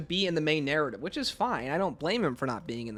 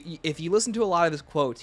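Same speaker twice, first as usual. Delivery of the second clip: ordinary talk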